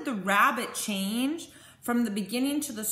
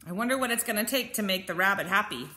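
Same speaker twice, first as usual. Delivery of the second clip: ordinary delivery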